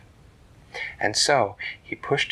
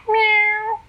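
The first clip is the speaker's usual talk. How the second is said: high loud kitten meow; 11 dB louder than usual. Acted. Kitten calling from the shell, high loud meow. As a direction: mew like a tiny kitten.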